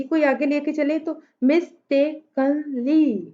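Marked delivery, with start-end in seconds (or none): 1.4-3.2 "mistakenly" in four slow beats, mis-tay-ken-lee; syllable by syllable. Shown as a demonstration. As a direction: break "mistakenly" into separate syllables. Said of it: "contains four sylla".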